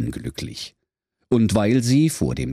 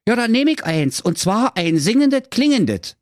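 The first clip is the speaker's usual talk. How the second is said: high, eager, thrilled hero voice; delighted; 3 dB louder than usual. Acted. Marco happily orders the singing freshener, high and delighted. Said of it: "rief er entzückt".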